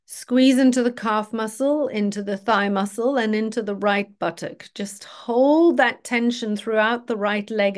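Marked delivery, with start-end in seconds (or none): none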